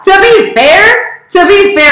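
high, loud, drawn-out preface; emphatic self-defence. Real, not acted. Pausing own old video to explain, high loud protest. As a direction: stretch it out high to cut in.

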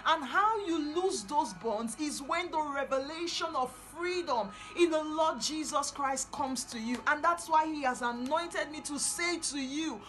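loud, rising preacher's cry; fiery preaching. Acted. Preacher urging the captive daughter of Zion, loud and fervent. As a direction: preach it out with full force.

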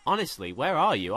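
ordinary delivery

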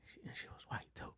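whispered aside about the cashier. Real, not acted. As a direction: drop to a whisper for the joke. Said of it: "whispered aside".

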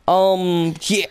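ordinary talk, nothing marked

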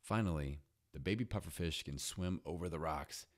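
usual speaking voice

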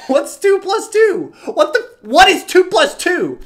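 high, loud, dopey questioning; exaggerated confused questioner; clueless pupil. Imitates clueless pupil asking.

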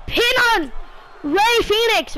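excited high play-by-play calls; wrestling commentator. Acted. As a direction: call the match like a commentator.

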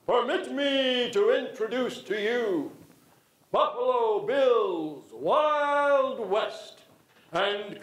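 slow, grand ringmaster announcement; booming announcer voice. Acted. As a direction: draw out each word like a showman. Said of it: "in a booming voice".

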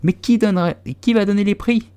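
high, loud, eager group question; crowd in chorus. A narrator's reading. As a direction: call out as a whole crowd asking. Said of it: "demandèrent les autres en chœur".

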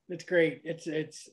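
ordinary delivery

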